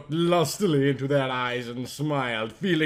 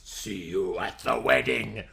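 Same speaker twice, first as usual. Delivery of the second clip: low, soft, dark vampire farewell; lower, softer vampire line said from the gurney; 6 semitones lower, 5 dB quieter than usual. Acted. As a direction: sink low and brooding like a vampire. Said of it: "he said in his dark vampire voice".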